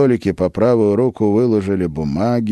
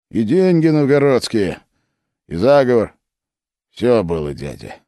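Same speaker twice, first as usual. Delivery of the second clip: hoarse mockery; 5 semitones higher than usual. A narrator's reading. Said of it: "хрипло и с издёвкой".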